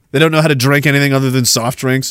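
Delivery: usual speaking voice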